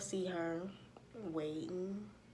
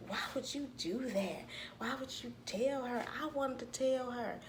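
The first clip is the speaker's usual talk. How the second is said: higher-pitched, imitated indignant complaint; mock protest; 6 semitones higher than usual. Sister protesting that she wanted to tell mama, mock indignant. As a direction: act out a wronged sister protesting. Retold quarrel between sisters.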